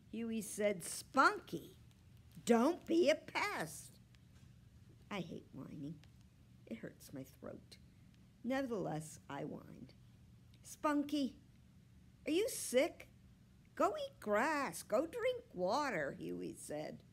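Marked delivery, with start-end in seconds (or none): 0.8-4.0 high, loud, drawn-out complaint; exasperated boy's raised voice; annoyed scolding. A narrator's reading. Annoyed boy scolds his whining dog. 10.6-16.1 higher, louder, impatient voice; raised-pitch annoyed dismissal; exasperated boy. A narrator's reading. Exasperated boy fobs off his dog.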